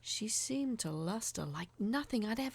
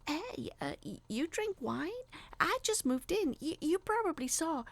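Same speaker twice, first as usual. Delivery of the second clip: stammering, higher, nervous greeting; nervous rehearsal; 9 semitones higher than usual. A narrator's reading. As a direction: rehearse the opener with jittery nerves. Nervously rehearsing speech to neighbor.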